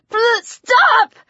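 high, breaking plea to stop; panicked shout. Acted. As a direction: beg him to stop in terror. Sarah begs the changed boy.

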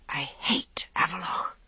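breathy, hushed private hatred; a soft, bitter inner vow; whisper. A narrator's reading. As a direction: whisper it to herself.

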